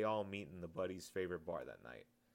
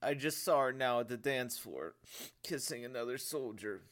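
higher, louder tearful voice; tearful young lad; 5 semitones higher, 6 dB louder than usual. Acted. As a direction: blurt it out through tears. Sergeant crying over girl.

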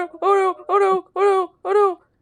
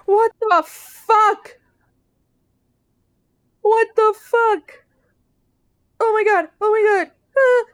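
higher, louder and drawn out slowly in shock; shocked disbelief; 5 semitones higher, 4 dB louder, slower than usual. Real, not acted. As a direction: stretch it out, stunned by what you see. Shocked reaction in horror game.

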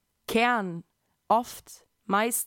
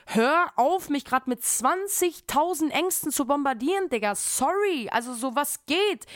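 higher, louder, fed up; exasperated retort; 6 semitones higher, 4 dB louder, slower than usual. Retold or imitated. Daughter snapping back at her worried mom, exasperated. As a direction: push back at the flood of worries. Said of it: "und ich bin so".

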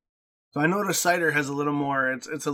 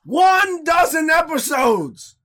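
excited shout; 13 semitones higher, 6 dB louder, much slower than usual. Real, not acted. Hyping the twelfth episode.

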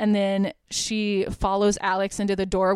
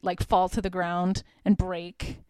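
ordinary delivery

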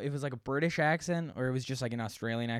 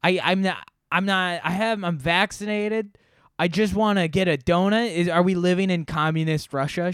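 raised, louder indignant customer act; mock outrage, pitched up; imitated powerful person; 4 semitones higher, 6 dB louder than usual. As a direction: protest like someone who thinks they're important. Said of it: "speaking like a, like a powerful per".

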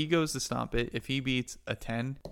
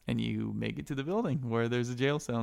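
usual speaking voice

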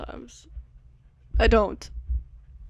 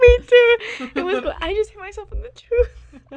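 laughing; 10 semitones higher, 4 dB louder than usual. Real, not acted. Startled, then laughing hurt.

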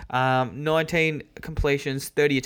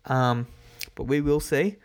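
ordinary talk, nothing marked

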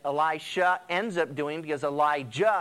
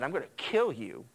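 mild seething threat; 5 semitones lower, 4 dB quieter than usual. Retold or imitated. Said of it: "got madder and madder".

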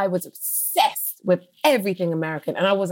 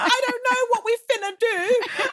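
high, giddy mock-swooning reply; smitten eagerness; 13 semitones higher than usual. Guest replies to the man's line, giddy and high. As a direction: gush back as if hopelessly charmed.

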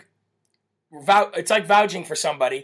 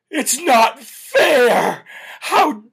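high, loud, slow trembling outrage; shaking with anger; 4 semitones higher, 6 dB louder, much slower than usual. Retold or imitated. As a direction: play the teen activist's furious speech. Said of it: "Greta Thunberg".

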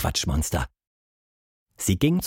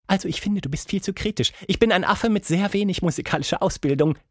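higher, quick chattering monkey; 6 semitones higher, faster than usual. Acted. Plappi the monkey to the bird, high quick chatter.

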